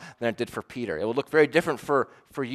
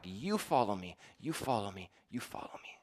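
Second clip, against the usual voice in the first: YOU leaned on, fading softer to a breath; stress on YOU; 3 semitones lower, 11 dB quieter than usual. Shown as a demonstration. Pastor stressing You to the congregation, fading softer. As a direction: press the word You, then let it die away. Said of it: "in that verse is emphatic".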